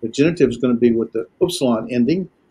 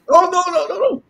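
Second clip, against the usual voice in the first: very high mock protest, no no no; emphatic no no no. Shown as a demonstration. Teacher acting out an emphatic denial. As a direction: squeal the noes in mock alarm. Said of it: "It's emphatic".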